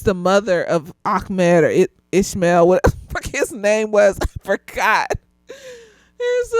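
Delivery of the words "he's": high, slow, laugh-cracked voice. Real, not acted. Describing the character, real laugh-cracked aside. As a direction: say it while breaking into laughs.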